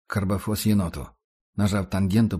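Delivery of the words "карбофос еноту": ordinary delivery, nothing marked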